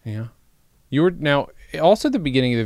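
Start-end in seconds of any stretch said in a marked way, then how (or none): none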